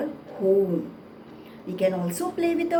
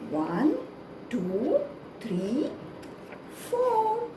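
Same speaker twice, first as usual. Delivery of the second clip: put-on broad singsong kids theatre; 4 semitones higher, 3 dB quieter, much slower than usual. Counting sides with children.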